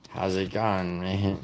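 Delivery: a light mock Australian greeting; Australian accent. Streamer greeting chat in a light mock Australian accent. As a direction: lightly toss off an Australian "how's it going". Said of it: "Is the easiest way to sound Australian".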